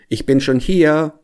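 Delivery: high, loud call with held last word. A narrator's reading. Hedgehog greets the hare at the top, calling out. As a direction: call it out to the runner. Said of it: "rief ihm der Igel entgegen".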